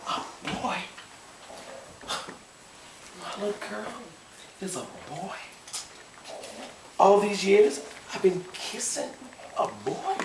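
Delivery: high, hushed and broken murmur; stunned grief. Acted. Father realising his little girl is a boy, hushed. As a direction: voice it softly, in halting pieces.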